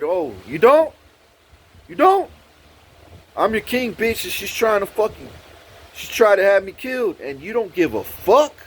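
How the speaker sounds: high, incredulous, heated challenge; indignant retort. Retold or imitated. Him confronting her after the slap, indignant. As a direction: build from disbelief into an outraged rant.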